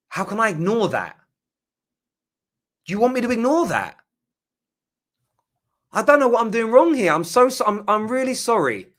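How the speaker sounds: high, loud, pleading exasperation; exasperated. Real, not acted. Streamer answering fans who attack him, loud and exasperated. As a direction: throw your hands up at the critics.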